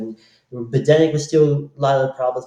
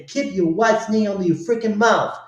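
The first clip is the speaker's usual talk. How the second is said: retold shout; 7 semitones higher than usual. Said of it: "shout, Chris Rock say".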